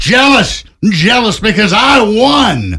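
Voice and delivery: high, loud indignant yell; angry yelling. Acted. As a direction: yell it like a sore winner. Acted scene, dad yelling.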